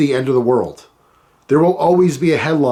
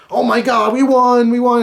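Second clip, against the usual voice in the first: mock victory cheer; 9 semitones higher than usual. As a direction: burst out as if your team won the championship. Reliving a team win.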